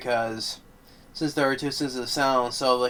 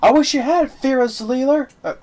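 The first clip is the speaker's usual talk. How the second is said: much higher, louder sarcastic barking back; heated argument; 8 semitones higher, 4 dB louder than usual. Retold or imitated. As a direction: throw the insult back in his face. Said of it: "Kevin Bacon gets on his face like".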